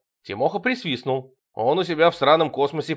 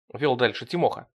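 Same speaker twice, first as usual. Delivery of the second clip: ordinary delivery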